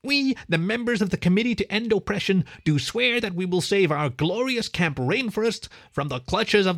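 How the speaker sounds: high-pitched, solemn pledge recital; very official. A narrator's reading. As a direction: read it out like a child's official oath. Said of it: "It sounded very official".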